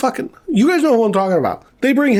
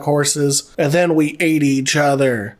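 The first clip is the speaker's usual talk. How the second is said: ordinary delivery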